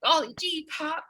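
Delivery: put-on high, bright, slowed sitcom kid; sarcastic golly-gee, Leave It to Beaver; wholesome 1950s kid. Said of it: "pretend you're happy to see me".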